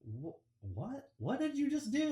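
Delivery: high, rising disbelief, mock shocked boss. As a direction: squeak out a stunned what as the boss. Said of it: "my boss looks at me like".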